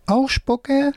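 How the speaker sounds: very high questioning puppet; high-pitched puppet, asking in wonder; left-hand puppet. A narrator's reading. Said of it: "Wolfram mit der linken Hand".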